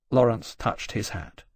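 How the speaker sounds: usual speaking voice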